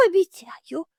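high, quick; eager promise. Acted. Little hare promises its parents eagerly.

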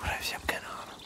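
hushed whisper. Acted. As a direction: keep it to a whisper. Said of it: "Ich flüster einfach weiter".

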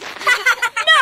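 bully's loud mocking laugh; loud jeering laugh. Bully laughing at his joke.

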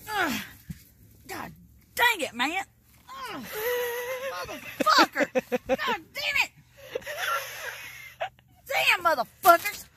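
yelling at the cover in real frustration; frustrated yelling. Real, not acted. Grandma yelling at the tangled cover, frustrated.

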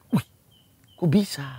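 hushed unvoiced aside; whispering in amazement at rising. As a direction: breathe it out in wonder.

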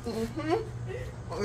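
ordinary talk

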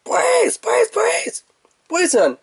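very high squeaky shouted monster call; shrill, high cry of a little yokai; creature cry imitation. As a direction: squeal it like a cartoon critter. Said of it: "he actually just talks like".

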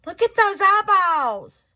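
high, loud squeal; a loud, high squeal of delight. Real, not acted. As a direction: squeal at how cute they are. Squealing at the cute eyeballs.

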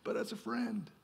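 high, then dropping to soft, a hint of dismissive gods; lightly voiced condescending gods; aloof gods. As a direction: brush them off from on high. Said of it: "gods ... looking down going".

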